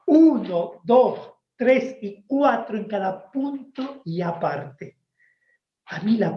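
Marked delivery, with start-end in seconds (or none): 0.1-5.0 slow, drawn-out counting of the beats; counting pauses. Shown as a demonstration. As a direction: count each pause out slowly. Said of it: "Había que contar". (contando pausas despacio)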